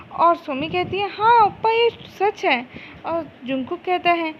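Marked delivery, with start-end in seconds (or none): 1.1-2.7 higher, louder start, eager to convince; insistent. Acted. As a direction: insist brightly when caught out. Somi caught out, insisting it is true. (ज़ोर देकर, ऊँचे सुर में यकीन दिलाते हुए)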